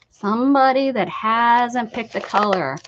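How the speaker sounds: usual speaking voice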